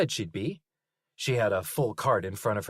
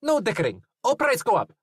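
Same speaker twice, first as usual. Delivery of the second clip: put-on louder, higher foreign-accented butcher; the butcher shouts at Zoe, thick accent; 12 semitones higher, 7 dB louder than usual. A narrator's reading. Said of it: "in a thick accent that Linus couldn't place".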